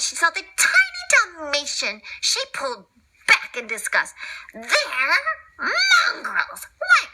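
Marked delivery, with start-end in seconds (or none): 4.5-7.1 Cruella disgusted. Acted. Cruella recoils from the spotless puppies, high disgusted cry. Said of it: "she cried out".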